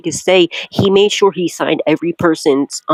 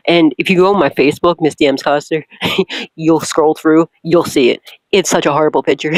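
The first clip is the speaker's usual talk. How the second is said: ordinary talk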